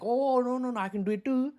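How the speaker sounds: high-pitched mock Indian accent. America copying China, in a mock Indian accent. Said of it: "they have an Indian accent".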